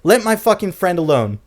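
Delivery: imitating a friend snapping at the driver; angry telling-off. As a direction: tell her off, furious. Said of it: "he's like".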